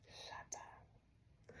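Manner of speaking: barely audible whispered exclamation; hushed disbelief; stunned whisper. Retold or imitated. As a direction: mouth it on a breath in amazement. Hushed reaction inside retold story.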